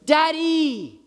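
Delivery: put-on high-pitched, drawn-out child's call for a parent; kid calling out. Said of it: "Like a kid calling out".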